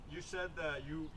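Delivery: ordinary talk, nothing marked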